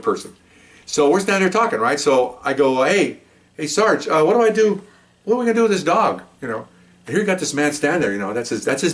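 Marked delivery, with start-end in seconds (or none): 2.8-6.2 higher, eager rookie voice asking the sergeant for help; a young officer anxiously calling out to his sergeant; rookie cop asking. Retold or imitated. Rookie officer asks the sergeant what to do, higher and eager.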